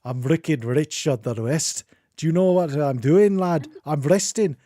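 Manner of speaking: put-on a light Scottish accent, grumpy witch. Host imagines the resting witch telling a lad off in a light Scottish accent. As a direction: shoo them off in a mild Scottish accent. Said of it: "that would actually be a very weird Scottish".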